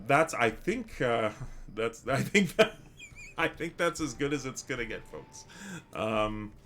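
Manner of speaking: ordinary talk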